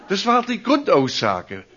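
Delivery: ordinary talk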